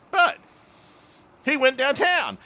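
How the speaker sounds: high, mock-excited yell of a punchline; retold punchline outburst. One brother setting the other off again, excited outburst.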